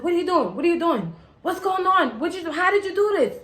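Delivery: high, loud frantic yelling of the other driver. Retold or imitated. Other driver yelling at her after the crash, high and panicked. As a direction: shout it like the shaken guy you hit.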